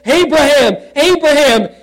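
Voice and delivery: loud, high call of the name; calling from heaven. Acted. Angel calling Abraham to stop, loud and high. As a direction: call out the name from far above. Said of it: "called unto him out of heaven".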